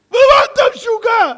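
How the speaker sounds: a loud, high, indignant shout; outraged shout. Acted. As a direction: yell it like you can't believe him. Priest outraged in joke.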